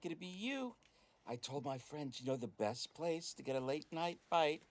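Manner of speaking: a sugary flirt at the door; coquettish high voice. Acted. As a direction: charm your way past a closed door. GM voices flirtatious player character.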